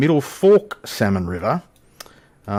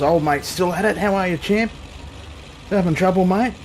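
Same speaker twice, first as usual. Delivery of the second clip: cheery greeting; 8 semitones higher than usual. Acted. Cheerily greets sim characters.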